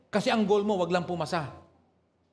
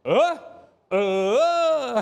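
high, loud stunned uh; mock Pangasinan amazement; a playful exaggerated grunt of wonder; amazed uh; 7 semitones higher, 7 dB louder than usual. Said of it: "yung kasunod".

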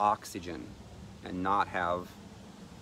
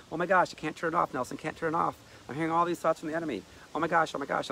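high, loud, fast frantic pleading; panicked; 8 semitones higher, 4 dB louder, faster than usual. Retold or imitated. Person tormented by thoughts begs Nelson, frantic and fast. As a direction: rattle it off in a tormented rush.

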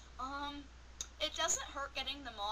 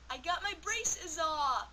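higher, louder, slow announcement; excited reveal; 4 semitones higher, 6 dB louder, slower than usual. Real, not acted. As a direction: proudly announce the news. Big reveal of braces off.

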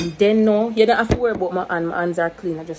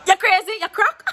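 mock outrage; 12 semitones higher, 4 dB louder than usual. Mock outrage at viewers' idea.

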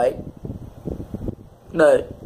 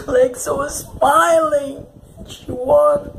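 tearful lament; 7 semitones higher, 4 dB louder, much slower than usual. Real, not acted. Grieving the character, repeated.

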